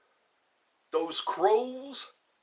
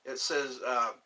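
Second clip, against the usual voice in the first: ordinary talk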